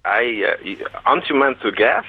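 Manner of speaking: put-on German-sounding accent; Germanic accent. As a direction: answer hesitantly as a vague Eastern European. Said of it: "now he's from Germany".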